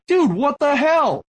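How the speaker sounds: high, drawn-out mock yell; retold scream. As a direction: imitate yelling across a poker room. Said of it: "screaming across this poker room".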